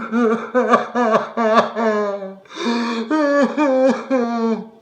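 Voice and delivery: high, loud laughing sob; hysterical laugh-sob. Acted. Grieving husband, hysterical laugh-cry. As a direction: break into crazed sobbing laughter.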